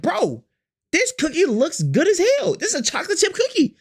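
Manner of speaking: high, eager cookie craving; mock-excited craving.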